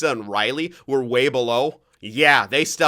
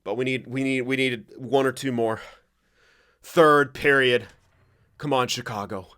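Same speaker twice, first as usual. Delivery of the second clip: normal talking voice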